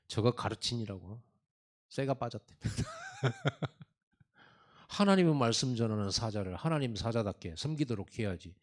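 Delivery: ordinary delivery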